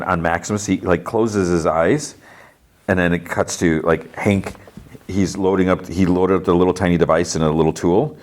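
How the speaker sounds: ordinary talk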